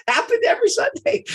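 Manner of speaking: said through high, loud laughter; laughing. Real, not acted. Laughing to the audience about dad. Laughing while telling story.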